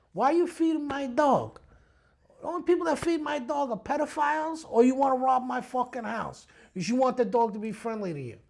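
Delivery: retold indignant tirade; angry confrontation. Retold quarrel with the neighbor.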